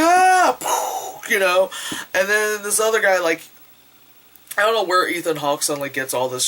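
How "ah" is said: a much higher hammed-up yelp of pain; high mock scream. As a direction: yelp like you're being hit with an axe. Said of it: "He's like".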